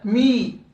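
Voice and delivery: "mi" with a long held ee; long vowel. Shown as a demonstration. Teacher showing mim followed by ya, "mi" held long. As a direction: draw out the vowel of "mi". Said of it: "suivie d'une voyelle longue".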